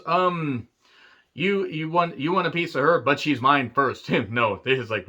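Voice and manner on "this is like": ordinary talk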